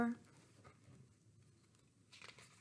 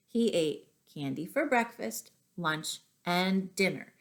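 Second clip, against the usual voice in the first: usual speaking voice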